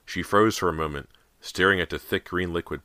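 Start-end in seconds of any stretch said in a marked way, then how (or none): none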